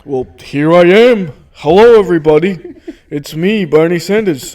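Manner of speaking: loud entrance as the politician guest; Bernie Sanders. Acted. Politician guest greets the podcast, hearty impression. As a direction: announce yourself in a put-on politician's voice. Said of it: "Bernie Sanders is a good friend of the show".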